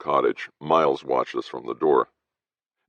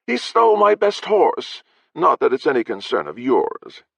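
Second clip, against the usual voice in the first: contempt; 12 semitones higher, 5 dB louder than usual. Acted. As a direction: look down on him with the last word. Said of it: "accent on the final word carried enough contempt".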